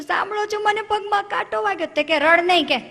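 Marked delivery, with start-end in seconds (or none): 0.0-1.9 high pleading tone; whiny complaint. Retold or imitated. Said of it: "રડતી રડતી ઘેર આવી". 2.2-2.8 louder, higher annoyed snapping; irritated scolding. Retold or imitated. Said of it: "tone જ બદલાઈ ગયો".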